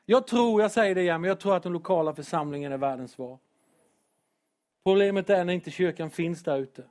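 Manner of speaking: normal talking voice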